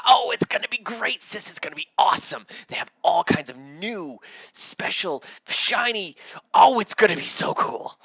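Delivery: loud, high, gushing excitement; thrilled little boy. Acted. Timmy jumped up to tell his sister, hugely excited. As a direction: burst out with kid-like glee, very loud.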